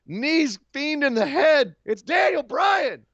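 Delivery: high, slow shouted reveal; excited reveal. Real, not acted. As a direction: announce it like a big pop. Excited recap of surprise.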